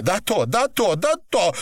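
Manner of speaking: high, urgent calling; crying out. A narrator's reading. Woman calls to torturer to stop, high urgent cry. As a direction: call his name in high desperation. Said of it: "кричала Наташа".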